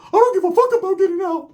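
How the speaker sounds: very high, squeaky mock voice; high cartoon voice imitation; Ricky's voice. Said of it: "Ricky's reply".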